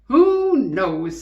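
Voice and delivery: loud shrugging farmer's wife; farmer's wife shrugging at her husband. A narrator's reading. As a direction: toss it off loudly. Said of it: "said Missus Tucker".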